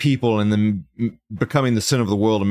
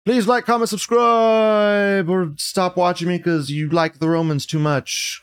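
upbeat outro; 8 semitones higher than usual. Real, not acted. Outro asking to subscribe.

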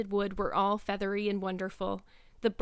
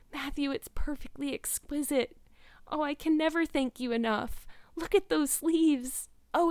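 high, awestruck gushing, opening on a hushed breath; overwhelmed, grateful Anne; 5 semitones higher than usual. Acted. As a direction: thank him as if living a dream.

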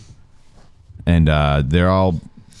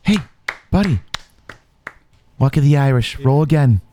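a light, higher buddy-guy voice; Mark Wahlberg impression; 8 semitones higher than usual. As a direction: pat the player on the back as the movie star. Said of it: "Mark Wahlberg appears and says".

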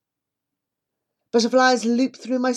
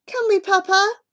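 put-on high, louder pleading child; eager plea; 13 semitones higher, 5 dB louder than usual. A narrator's reading. Asha begging Papa to ride the elephant, high and eager. Girl begs father.

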